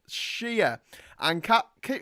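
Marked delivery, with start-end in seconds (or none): 0.1-0.8 "Shia" as SHEE-uh, higher, falling; "shee-uh" pronunciation. Shown as a demonstration. Host showing the word instead of "sure", SHEE-uh. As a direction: say "Shia" with a long ee, not "sure". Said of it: "saying that right instead of sure".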